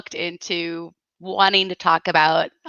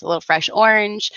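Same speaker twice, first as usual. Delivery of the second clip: ordinary talk, nothing marked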